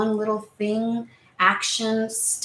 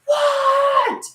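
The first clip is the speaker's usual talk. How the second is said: a loud, much higher, drawn-out what; exasperated what; 7 dB louder than usual. Retold or imitated. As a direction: snap at the interruption, fed up. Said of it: "And you're like".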